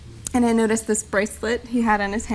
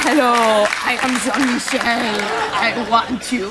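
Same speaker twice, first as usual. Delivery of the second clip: lightly put-on French accent, teasing; a hint of a phony French accent; mock French accent. Quoting husband's fake French phone message, light mock accent.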